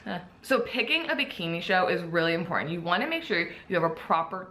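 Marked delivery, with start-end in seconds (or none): none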